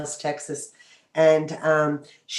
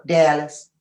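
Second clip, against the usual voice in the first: put-on slurred Dallas, Texas accent; [name]; 4 dB louder than usual. Friend asked where she's from, slurred Texan accent. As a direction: let the Texas accent slip out. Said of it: "That slur would come right through".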